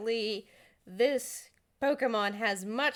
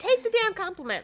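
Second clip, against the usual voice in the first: exasperated; 9 semitones higher, 5 dB louder, faster than usual. Real, not acted. GM exasperated at refused compliment.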